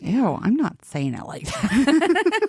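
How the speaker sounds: high, slow, grossed-out mock disdain; disgusted. Speaker mocking how other clans say it, disgusted. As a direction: recoil from the word with mock disgust. Rejecting another clan's pronunciation.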